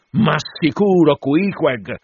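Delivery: excited captain's reply; eager confirmation. Acted. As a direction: confirm it with loud, mounting conviction.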